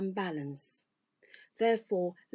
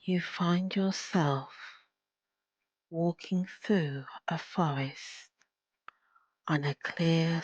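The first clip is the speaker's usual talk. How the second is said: slow meditation voice with long pauses; guided meditation; 2 semitones lower, slower than usual. Acted. Leads a guided visualization.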